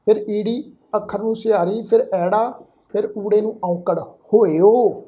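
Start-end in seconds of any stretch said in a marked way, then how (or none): 4.0-5.0 ਹੋਇਓ very slow, "ho-i-o" with every vowel sounded; drawn-out demonstration. Shown as a demonstration. Teacher showing how the spelling is read, slow "ho-i-o" in ਹੋਇਓ. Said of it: "ਇਸ ਦਾ ਪਾਠ ਉਚਾਰਨ ਕਰਾਂਗੇ".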